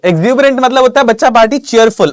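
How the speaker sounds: high, loud, eager answer reveal; excited reveal. Real, not acted. Teacher excitedly revealing the answer to his students. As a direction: announce the answer with a burst of excitement.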